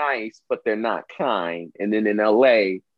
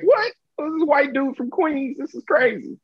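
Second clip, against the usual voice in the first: much higher, a shocked yelp on the first word; stunned disbelief. Retold or imitated. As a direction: replay your jaw-dropped surprise, voice shooting way up. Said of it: "I'm like".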